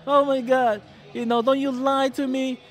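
high-pitched imitation of an upset girlfriend; acted-out lovers' quarrel; mock distraught accusation. Acting out girlfriend's tearful accusation.

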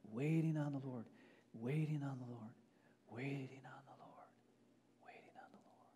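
trailing off into a hushed whisper; fading to a whisper. Acted. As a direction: let the words die away into breath. Repeats phrase, fading to whisper.

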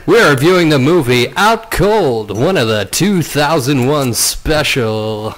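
hammed-up DJ delivery, laughing through it; radio voice. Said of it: "I'm gonna do a whole podcast in radio voice".

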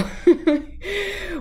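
high burst of laughter; quick amused laugh; real laugh. Real, not acted. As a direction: laugh at yourself, high and bright. Laughing at own failed line.

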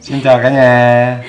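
slow, loud showy hello; loud greeting. Acted. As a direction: call out to the viewers, drawn out. Greets the viewers as a show.

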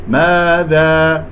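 ماذا slow with open alif; correct ماذا. Shown as a demonstration. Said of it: "هكذا".

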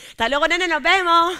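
despedida alta y fuerte hacia los colegas; llámales de lejos, agudo y fuerte; despedida cantarina. Acted. (despedida alegre y gritada a los colegas)

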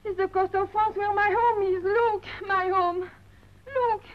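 high, loud, tearful cry of homesickness; choking up at a picture of home; overcome, crying out over her lost home; tearful longing. Acted. Sees her home, weeping.

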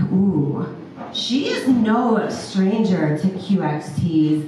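usual speaking voice

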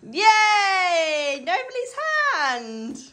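high, loud little cheer; excited cheer. Acted. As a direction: cheer her on. Cheering toddler's handprint.

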